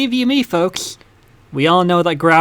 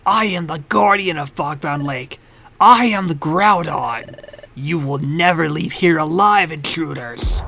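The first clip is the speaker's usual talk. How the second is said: low threatening monster voice; 4 semitones lower than usual. Acted. Groudon character voice, threatening.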